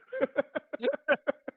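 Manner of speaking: real laugh breaking out high; high burst of laughter. Real, not acted. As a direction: cracks up at the punchline, pitch jumping up. Laughing at his own story.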